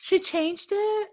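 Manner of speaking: high, slow, stunned question; surprised. Real, not acted. Friend reacting to news, high surprised disbelief. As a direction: disbelief that she changed it.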